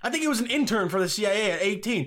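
normal talking voice

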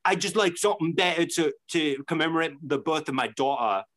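put-on British storytelling voice; British accent. Retelling what the dad wanted, mock British accent. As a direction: imitate a British bloke explaining himself. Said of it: "doing a British accent".

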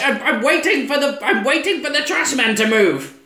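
high, loud put-on old lady; old woman voice. The parked woman answering, high mock old lady voice. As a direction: whine it like a dotty old lady. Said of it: "the woman's like".

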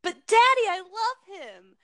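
mock a lovesick teen begging daddy; mock Ariel pleading with her father. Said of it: "when she goes".

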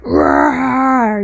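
a hammed-up little monster growl; mock Godzilla roar. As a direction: roar like a cartoon Godzilla, tongue in cheek. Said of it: "like Godzilla".

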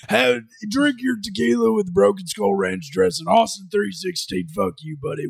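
gruff Texas wrestler growl; Stone Cold impression. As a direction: deliver it like a beer-smashing promo. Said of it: "He's like".

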